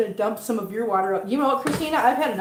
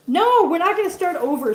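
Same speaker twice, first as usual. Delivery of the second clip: mock indignant; 6 semitones higher, 4 dB louder than usual. Real, not acted. Refusing a viewer's joke.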